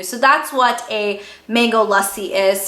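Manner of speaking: ordinary talk, nothing marked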